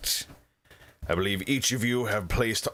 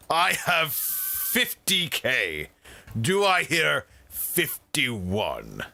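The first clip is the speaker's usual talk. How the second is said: gleeful; 6 semitones higher, much slower than usual. Acted. Mayor gloating over huge bid.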